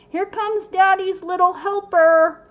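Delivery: loud, high playful call out the door; calling out to Dennis, loud and high. Retold or imitated. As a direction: sing it out to her husband outside. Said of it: "I yelled out to Dennis".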